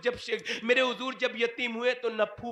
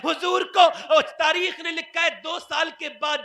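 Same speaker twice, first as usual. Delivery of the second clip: fiery preaching; 5 semitones higher, 5 dB louder than usual. Acted. Preaching at full force.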